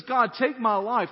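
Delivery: a heavy, begging cry; pleading outburst. Retold or imitated. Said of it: "He says".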